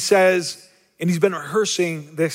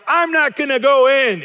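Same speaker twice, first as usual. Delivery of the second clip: put-on higher, louder sulky refusal like a pouting child; mock hissy fit; 7 semitones higher, 4 dB louder than usual. Older brother refusing to join the party, mock sulky pout. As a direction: stamp your foot and refuse, hammed-up. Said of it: "he throws a hissy fit".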